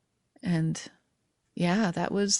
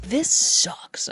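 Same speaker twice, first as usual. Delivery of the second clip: a muttered, breathy aside to myself; retold fed-up whisper; whispered complaint; 5 semitones higher than usual. Retold moment of misery, manual labor.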